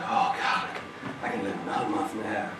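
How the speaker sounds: breathy, hushed thank-God whisper; whispered relief. Retold or imitated. Relieved man whispering to himself about his reprieve, hushed. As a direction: sigh it out as if saved.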